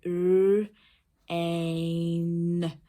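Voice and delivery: r and ain voiced as separate sounds; sounding out. Shown as a demonstration. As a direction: sound out "r, ain" loudly, drawing out each sound. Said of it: "put the sound buttons on".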